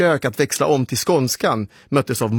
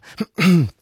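preparatory throat clear, pitched up; 6 semitones higher, 4 dB louder than usual. Acted. Boy stalling before naming band, clearing his throat. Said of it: "jag harklade mig".

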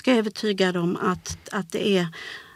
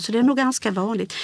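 ordinary talk

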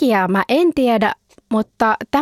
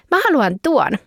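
put-on higher eager child voice; 6 semitones higher than usual. Anna telling grandma which horse she wants, eager child voice. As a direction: point at the toy you want. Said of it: "hän sanoo".

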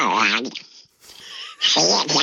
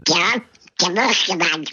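squawky, spluttering duck voice; Kalle Anka. Shown as a demonstration. Guest answers as the character when host asks, in duck voice. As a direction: quack each phrase like a cartoon duck. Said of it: "då hittar du exakt hans".

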